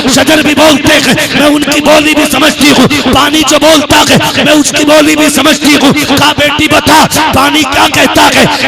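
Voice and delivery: high, loud rapturous sermon storytelling; full-force preaching. Acted. Preacher telling the crowd of Amina at the well, impassioned full-force delivery. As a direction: build to a loud, high-pitched peak and hold it there.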